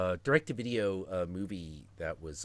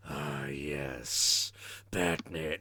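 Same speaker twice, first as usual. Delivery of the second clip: low, menacing villain voice; deep, terrifying cartoon villain; Two-Face; 6 semitones lower, much slower than usual. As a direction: drop deep for a sinister greeting. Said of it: "He's playing Two-Face".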